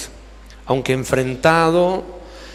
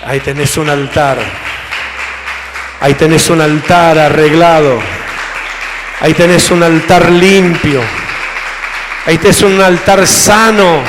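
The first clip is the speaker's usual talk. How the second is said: loud, ringing proclamation with pauses; full-force preaching; 6 dB louder than usual. Real, not acted. Preacher declares to congregation that the altar is repaired, forceful preaching. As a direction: proclaim each phrase at full volume.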